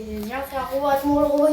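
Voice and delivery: ordinary talk, nothing marked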